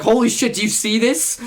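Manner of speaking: high, loud retold astonishment; amazed exclamation. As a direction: burst out with it to your friends. Retold running up to friends, amazed.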